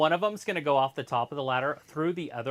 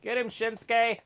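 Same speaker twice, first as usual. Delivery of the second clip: cheering; 9 semitones higher, 9 dB louder than usual. Real, not acted. Cheering wrestler on.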